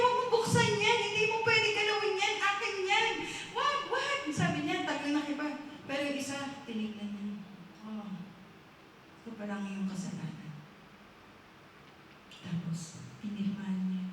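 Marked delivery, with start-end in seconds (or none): 0.0-4.2 high-pitched desperate protest; acted frantic pleading. Man begging stranger not to open files, frantic. 7.9-10.5 low, soft, quiet pronouncement; acted calm. Visitor looking at the man's sins, calm and quiet.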